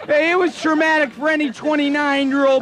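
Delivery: ordinary talk, nothing marked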